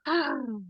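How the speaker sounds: long sighing release from the belly; heavy exhale. Shown as a demonstration. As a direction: let the breath fall out with a sigh. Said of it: "from your belly".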